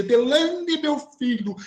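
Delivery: name swooping high and drawn out; theatrical astonished call. Retold or imitated. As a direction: imitate her dramatic "Delane!". Imitating the woman's dramatic address. (chamado teatral de espanto)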